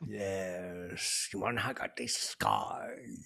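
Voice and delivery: low menacing villain voice; quiet creepy Joker drawl; Joker impression. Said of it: "I'm like the Joker".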